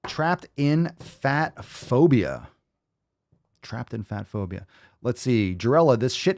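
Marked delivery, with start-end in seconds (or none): 0.1-2.5 slow, drawn-out, high announcer call; dramatic announcer. Acted. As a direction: stretch it like a big title reveal. Announces the video title dramatically.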